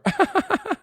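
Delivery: high-pitched burst of laughter; laughing. Real, not acted. Streamer laughing at a viewer's Welsh joke, high laugh. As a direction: crack up in a high giggle.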